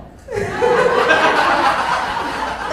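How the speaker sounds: big burst of laughter; cracking up loudly. Real, not acted. Big laugh at own joke.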